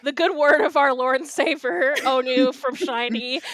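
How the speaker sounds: loud evangelist pitch, fast; preacher voice. Pretend. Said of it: "operated as a".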